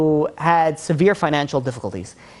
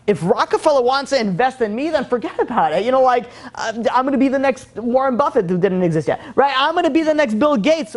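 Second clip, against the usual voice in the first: higher-pitched, carried-away boasting; acted elation; 6 semitones higher than usual. Debtor after finding Rockefeller's check, elated. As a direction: play a man suddenly sure of his glorious future. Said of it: "he's in the next world".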